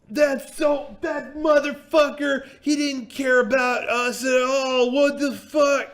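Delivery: high, drawn-out outraged shouting; mock a drunk losing it at full volume; furious yelling. Drunk passenger finding the driver gone, furious yelling.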